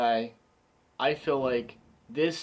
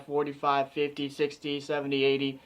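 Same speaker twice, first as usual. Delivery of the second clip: normal talking voice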